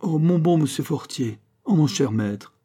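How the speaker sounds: mild despair, high start then falling; despairing plea. A narrator's reading. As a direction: implore the master. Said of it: "répondait l'écolier au désespoir". (supplication désespérée)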